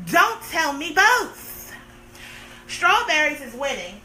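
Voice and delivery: ordinary talk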